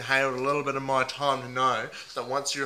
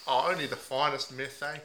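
a lightly put-on Aussie bloke accent, mate on every line; 3 dB louder than usual. Host playing a heartbroken meth packer in a mild put-on Aussie accent. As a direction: ham up the ocker Aussie, leaning on mate. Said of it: "put on a few different voices".

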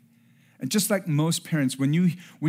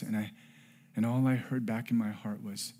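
ordinary talk, nothing marked

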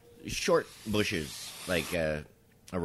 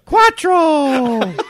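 high, blaring neighbor impression; loud Spanish counting; 15 dB louder than usual. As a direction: call the number out piercingly, mimicking her. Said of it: "in loud Spanish".

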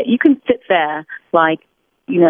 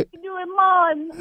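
mock Jamaican accent; 12 semitones higher, 6 dB quieter, slower than usual. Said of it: "American bobslayer people, like".

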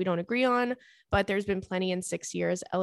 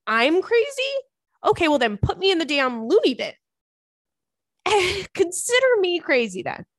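mock outraged disbelief; 9 semitones higher, 6 dB louder than usual. Real, not acted. Mock outrage at being called crazy.

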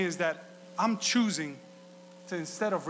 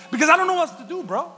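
frustrated student; 9 semitones higher, 7 dB louder, faster than usual. Retold or imitated. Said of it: "I looked at him and I said".

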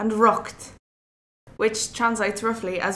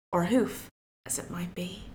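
wry aside; 5 semitones lower, 9 dB quieter than usual. A narrator's reading. Wry aside after the deer fight.

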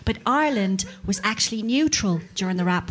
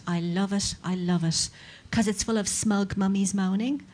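ordinary talk

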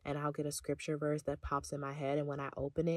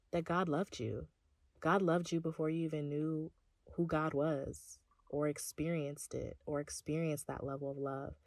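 normal talking voice

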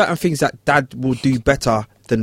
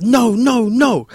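put-on high, loud child's wail of no; kid wailing no; 11 semitones higher, 4 dB louder, much slower than usual. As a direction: whine it like a crying four-year-old. Kid refuses again.

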